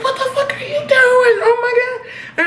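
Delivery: high, frantic squeal of alarm; hammed-up horrified friend; mock panic. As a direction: play Veronica freaking out as Betty walks off. Veronica panicking as Betty leaves.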